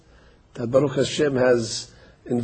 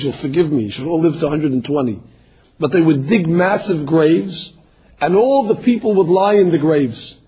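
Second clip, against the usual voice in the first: normal talking voice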